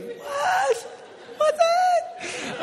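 mock traumatized squeaky voice; voice up high. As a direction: ask it in a comic falsetto. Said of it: "my voice went up that high".

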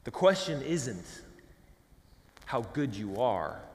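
ordinary talk

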